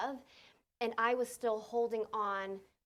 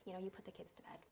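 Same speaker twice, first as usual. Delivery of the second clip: put-on whispered, fast, so the kids won't hear; mother whispering to husband outside the camper; hushed; 3 semitones lower, 9 dB quieter, faster than usual. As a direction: murmur it low and quick, then fade to a breath. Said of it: "And I just said".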